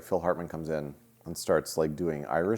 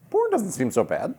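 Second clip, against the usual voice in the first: mock Heather Graham voice; 12 semitones higher, 3 dB louder than usual. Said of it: "she was like".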